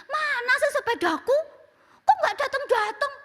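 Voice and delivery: high whiny impatience, half laughing; impatient child. Retold or imitated. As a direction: complain like a kid tired of waiting. Impatient child waiting for bike. (anak merengek tidak sabar)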